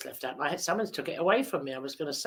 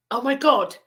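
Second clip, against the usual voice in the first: a touch of alarm, slightly raised; mock alarm; 6 semitones higher, 5 dB louder than usual. Boy to his friends, mildly alarmed. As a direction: play-act the shock of a missing boy.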